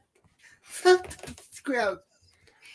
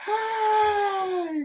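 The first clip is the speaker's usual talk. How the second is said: exasperated wail; 10 semitones higher than usual. Real, not acted. Exasperated over Seahawks fans.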